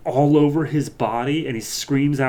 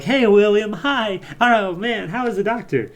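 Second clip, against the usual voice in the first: fake friendly kids; 9 semitones higher than usual. Bullying kids greeting William with fake cheer.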